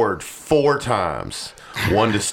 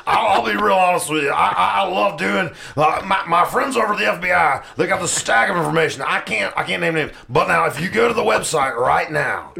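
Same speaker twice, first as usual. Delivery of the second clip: high-pitched, stammering crazed ranter; bringing out a character for the show, batshit crazy impression; Alex Jones; 9 semitones higher than usual. Podcast host starts a character bit, crazy ranting impression. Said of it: "Alex Jones".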